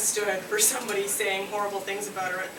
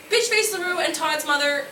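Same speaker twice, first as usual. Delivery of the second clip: usual speaking voice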